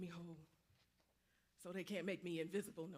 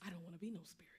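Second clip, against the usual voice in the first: hushed, breathy whisper; a ghost whispering; 3 semitones lower, 9 dB quieter, much faster than usual. Acted. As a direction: breathe it out under your voice. Ghost pleads quietly.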